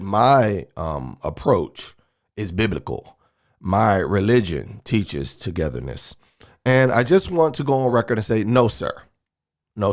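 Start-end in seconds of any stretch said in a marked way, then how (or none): 0.0-6.2 low, slow mock quote of his words; quoting Walker. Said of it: "and I quote, he said".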